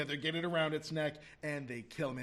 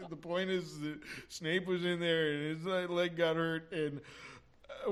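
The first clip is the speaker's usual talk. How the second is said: usual speaking voice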